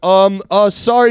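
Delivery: high, loud mock fumbling; flustered customer. Random customer answering the shop woman, flustered. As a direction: imitate an apologetic customer caught off guard. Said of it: "everyone flustered".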